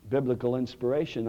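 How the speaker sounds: ordinary delivery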